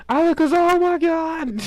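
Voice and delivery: high, slow, loud shocked cry; startled shout. Real, not acted. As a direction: blurt it out in shock. Player's startled outburst.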